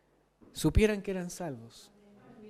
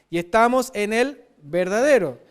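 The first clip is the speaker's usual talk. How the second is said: "verdadero" said louder and leaned on; stress on verdadero; 7 dB louder than usual. Shown as a demonstration. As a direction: press on "verdadero" in the quote. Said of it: "la palabra verdadero está escrito tres veces". (énfasis en "verdadero")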